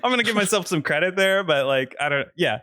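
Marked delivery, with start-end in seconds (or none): 0.0-1.4 laughing. Real, not acted. Laughing reply to the tease.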